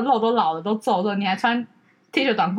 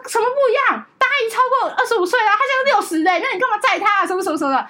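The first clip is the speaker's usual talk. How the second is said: higher, outraged sister demanding why; indignant protest; 8 semitones higher than usual. Retold or imitated. Sister protesting to brother why the aunt gets a ride, high and indignant. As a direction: push back hard, voice pitched way up in protest.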